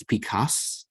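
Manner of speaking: Picasso with the s held across syllables; Pi-cas-so split into syllables; doubled s. Shown as a demonstration. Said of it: "Cas is one syllable".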